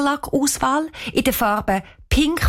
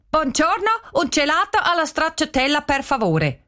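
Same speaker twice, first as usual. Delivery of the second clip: mock Italian; 7 semitones higher than usual. A narrator's reading. Girl tries Italian.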